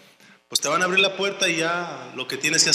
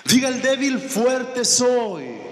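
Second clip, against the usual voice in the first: rally the flock with a raised call; preaching call; 7 semitones higher, slower than usual. Acted.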